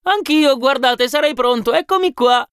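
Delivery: very high, excited clamour of voices; laughing volunteers. A narrator's reading. Many workers jokingly volunteer as chief, high laughing clamour. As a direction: pipe up eagerly, mock-volunteering in a high voice. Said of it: "dissero molti ridendo".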